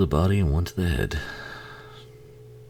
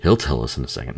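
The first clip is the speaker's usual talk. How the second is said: ordinary delivery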